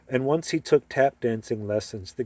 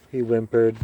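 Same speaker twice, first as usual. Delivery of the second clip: ordinary delivery, nothing marked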